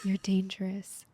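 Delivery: slow breathy whisper, seductive; sultry whisper. Pretend. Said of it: "in your best sultry voice".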